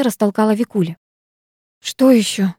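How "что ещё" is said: frightened. A narrator's reading. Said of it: "испуганно произнесла я".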